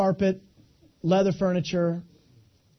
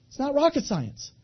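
ordinary delivery

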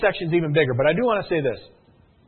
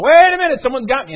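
high, loud cry of alarm; acted panicked outburst; 8 semitones higher, 11 dB louder than usual. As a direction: yell it like a victim suddenly overwhelmed. Victim retold, panicking under pressure.

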